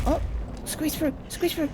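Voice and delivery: high urgent pleading. Real, not acted. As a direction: squeak it out, begging. Pleading while squeezing past.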